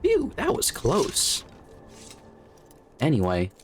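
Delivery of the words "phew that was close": relieved. Pretend. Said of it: "the bit where I am irrationally afraid of skeletons".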